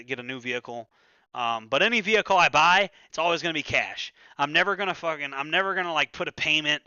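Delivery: normal talking voice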